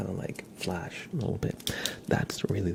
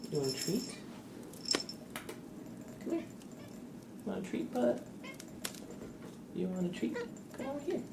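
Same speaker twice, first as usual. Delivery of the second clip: very high, soft coaxing to a pet; 8 dB quieter, faster than usual. Real, not acted. Streamer calling her dog over for a treat in high baby talk.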